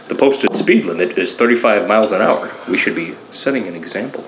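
low, measured robotic delivery; lightly voiced robot cop quoting regulations; RoboCop. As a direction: state it like a machine, low and even. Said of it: "RoboCop looks at her, he's like".

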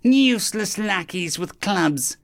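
slow, high-pitched disdain; drawn-out villain scorn; dismissive alien captain. Acted. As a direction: dismiss the minions with contempt.

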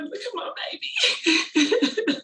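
high, drawn-out laughing pride; proud gushing. Real, not acted. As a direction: gush over her like a proud mom. Proud mom watching daughter sell.

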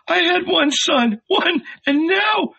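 very high, tearful wail; anguished mother's lament; heart-rending cry. A narrator's reading. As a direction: cry out in broken despair. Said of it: "heard the heart-rending cry".

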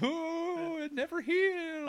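very high ghostly wail; unearthly voice. Pretend. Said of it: "I'm the spooky shadow".

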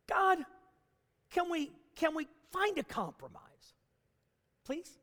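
tentative, high-pitched plea that fades away; pleading. Acted. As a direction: bargain meekly with God, softening to a small please. Acted bargaining plea to God.

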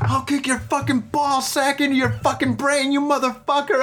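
high, strained mock threat; imitated yelling clerk. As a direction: rage at a child like an over-the-top shopkeeper. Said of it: "The guy was fucking yelling at me".

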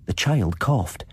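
usual speaking voice

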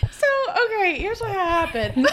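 high voice breaking with laughter; laughing through tears. Real, not acted. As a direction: try to talk while crying laughing. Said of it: "tears are streaming down my face".

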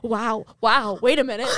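imitating a panicking roommate; mock panic. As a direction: act out a stunned, panicky double take. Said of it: "like, panicking".

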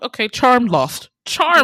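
loud, punchy pronouncement; emphatic verdict. Retold or imitated. Reacting to Peter saying girls talk too much, emphatic verdict. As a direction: declare it loudly, hammering each word. Acted dismayed reaction to rude remark.

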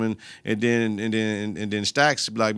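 ordinary delivery

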